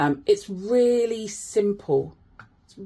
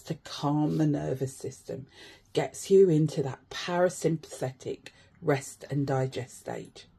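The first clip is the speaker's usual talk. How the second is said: calm grounding exercise; 3 semitones lower, 5 dB quieter than usual. Acted. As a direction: talk them gently through each sense.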